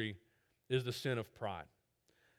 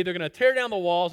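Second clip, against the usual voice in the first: normal talking voice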